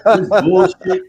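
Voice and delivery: high, loud laughing outburst; laughing yell. Retold or imitated. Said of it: "I was just yelling at it".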